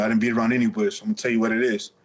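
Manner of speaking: ordinary talk, nothing marked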